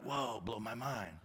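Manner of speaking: a hint of a dazed brunch server; mock server reaction. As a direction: play a stunned waiter. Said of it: "they'll be like".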